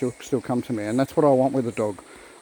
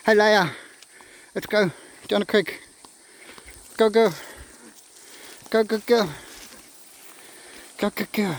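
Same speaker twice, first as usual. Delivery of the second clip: calling the dogs; 6 semitones higher than usual. Real, not acted. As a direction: sing out to the dogs to come along. Calling dogs to come along.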